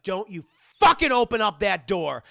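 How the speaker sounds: loud, threatening roar at her; furious command. Acted. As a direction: bark it like a villain blocking the door. Hyde stops Anna leaving.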